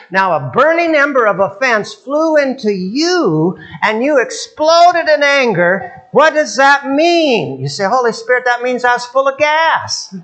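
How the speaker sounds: high, slow, rising preacher's cry; slow fiery pulpit delivery; preaching at full force. Acted. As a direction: lift the crowd with a slow sermon climax.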